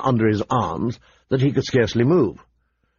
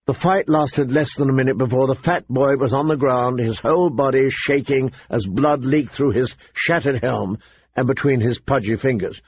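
usual speaking voice

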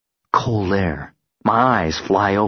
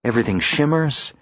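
plain reading voice

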